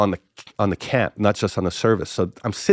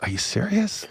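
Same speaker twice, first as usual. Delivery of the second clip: high, softer incredulous question; retold disbelief; 10 semitones higher, 6 dB quieter than usual. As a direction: ask it in hushed, high-pitched disbelief. Incredulous reply to pastor's offer.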